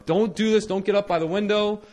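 high, loud re-enacted telling-off; scolding yell. Caretaker warning kids away from the window, scolding yell. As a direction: tell the kids off loudly, high voice. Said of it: "I yell at them".

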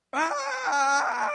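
long, high shouted war cry; battle cry. Acted. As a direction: roar like a charging army. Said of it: "they just go".

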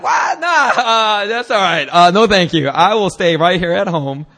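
loud, taken-aback mock refusal; acted alarm settling into a firm no-thanks; startled refusal. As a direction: balk loudly, then politely decline.

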